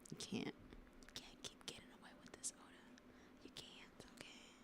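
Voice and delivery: hushed, secretive whisper at Oda. Shown as a demonstration. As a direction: lean in and whisper the warning. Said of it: "I'm gonna just say that one more time".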